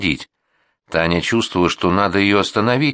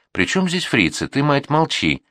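higher, mildly irritated interruption; cutting her off; 8 semitones higher than usual. A narrator's reading. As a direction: cut in to silence her. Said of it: "оборвал её Алексей".

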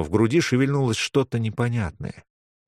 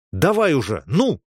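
higher, impatient push; impatient urging; 5 semitones higher, 3 dB louder than usual. Acted. Man demanding the amulet at once, impatient urging. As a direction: hurry him up, out of patience.

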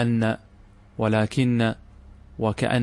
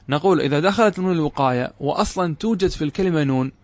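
ordinary talk, nothing marked

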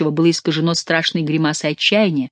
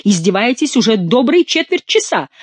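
high-pitched exasperated outburst; angry cry; 6 semitones higher, 4 dB louder than usual. A narrator's reading. Count accuses Saint-Luc of mocking him, angry cry. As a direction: raise the pitch in outrage. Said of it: "вскричал, побледнев".

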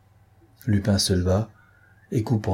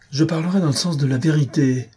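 higher, ringing, passionate declaration; ardent cry; 7 semitones higher than usual. Acted. Beautrelet defying Lupin, an ardent higher cry. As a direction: proclaim the truth with fervour. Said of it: "s'écria Beautrelet ardemment".

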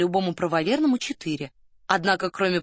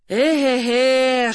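loud, long drawn-out moan of a sigh; noisy sigh; 4 semitones higher, 6 dB louder than usual. A narrator's reading. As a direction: heave a loud, voiced sigh. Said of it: "шумно вздохнул Соболев". (шумный протяжный вздох)

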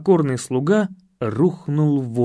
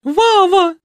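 very high, loud, drawn-out shriek of a name; истошный вопль; 8 dB louder than usual. Acted. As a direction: shriek it joyfully from the stands. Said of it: "завопила Николетта". (пронзительно завопить)

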